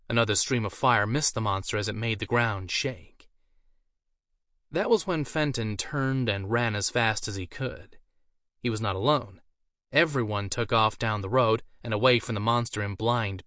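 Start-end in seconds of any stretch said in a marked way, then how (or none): none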